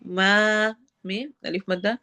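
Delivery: ordinary talk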